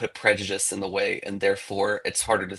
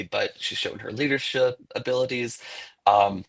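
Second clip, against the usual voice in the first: normal talking voice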